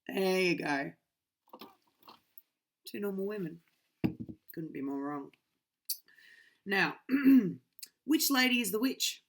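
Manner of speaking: plain reading voice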